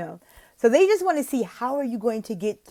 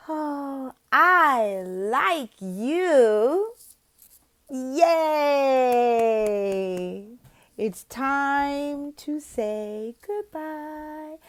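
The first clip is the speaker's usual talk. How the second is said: high, very slow singsong; sweet farewell; 6 semitones higher, much slower than usual. Shown as a demonstration. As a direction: stretch it out affectionately for a child. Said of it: "So then you'll say".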